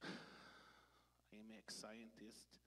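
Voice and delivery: sighed, very slow and quiet; hammed-up self-pity; mock sigh. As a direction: let it sink like a sad admission. Sighs, repeats title sadly.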